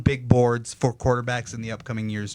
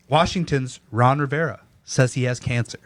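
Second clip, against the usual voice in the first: ordinary talk, nothing marked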